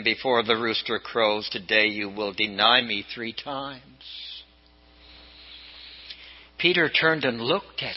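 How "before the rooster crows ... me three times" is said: low, slow, grave reading; solemn prophecy. Acted. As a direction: let the Lord's words land heavy and slow. Jesus' words remembered, read solemnly.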